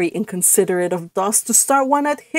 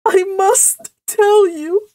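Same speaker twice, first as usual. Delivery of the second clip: high, slow tearful wail; loud, acted sobbing; 10 semitones higher, 5 dB louder, much slower than usual. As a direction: sob it, but keep the words clear. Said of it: "she sobbed".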